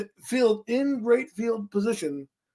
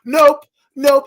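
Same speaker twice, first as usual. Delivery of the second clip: sharp, loud, higher "Nope"; emphatic loud denial; 3 semitones higher, 10 dB louder than usual. Real, not acted. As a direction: slam the door on it. Mocking the kicker's failure.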